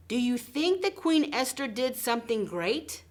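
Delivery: ordinary talk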